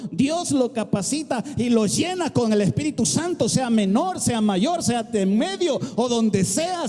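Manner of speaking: loud, rising preacher's cry with music; preaching shout. Real, not acted. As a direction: build the congregation up with force.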